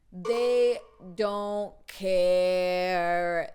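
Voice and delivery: "They don't care" loud and hugely drawn out; exasperated emphasis. Real, not acted. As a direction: stretch each word of "They don't care", loudly. Exasperated verdict on security guards.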